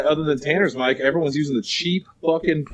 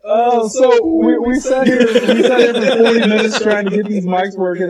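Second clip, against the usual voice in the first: exasperated rant; 6 semitones higher, 4 dB louder than usual. Real, not acted. Venting frustration.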